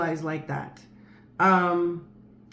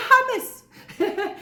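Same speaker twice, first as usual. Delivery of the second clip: much higher, laughing through the word Hummus; imitated giggly little girl; giggling child. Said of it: "She said".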